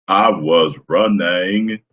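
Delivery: very slow drawn-out Gump line; slow mock Forrest Gump; Forrest Gump impression. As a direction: stretch every word like Forrest. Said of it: "Tom Hanks I can do".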